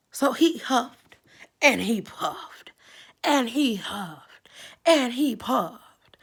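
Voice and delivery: slow and loud, stretched out; read-aloud suspense, slow and loud; slow dramatic huffing. A narrator's reading. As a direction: drag each huff and puff out slowly. Wolf blowing at the house.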